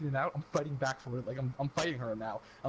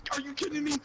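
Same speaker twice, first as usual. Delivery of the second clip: outraged mom; 12 semitones higher than usual. Retold or imitated.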